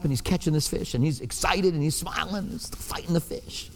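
hushed, watching him quietly; hushed telling. Real, not acted. Pastor whispering as he recalls the teen's catch. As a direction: drop to a whisper toward the end.

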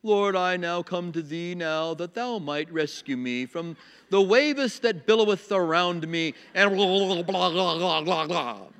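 slow, stuffy mock-religious voice, dissolving into blahs; pompous prayer. Mocking a religious man praying for rescue, pompous intoning. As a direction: intone it with overblown piety, then babble. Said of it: "He didn't get all pompous".

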